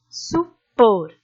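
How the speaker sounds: supor with a held por; stressed final syllable. Shown as a demonstration. Teacher showing the last syllable is stronger, lingering on por in supor. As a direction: split supor and lean on por. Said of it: "a sílaba mais forte a gente se demora".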